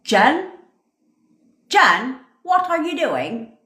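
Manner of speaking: a lightly voiced coworker calling her out, half-joking; Bevy scolding. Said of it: "Bevy would be like".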